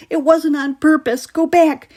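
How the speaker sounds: high, loud urgent shout; boy shouting at his brother. A narrator's reading. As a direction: yell it in his face. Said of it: "he shouted in Ryan's face".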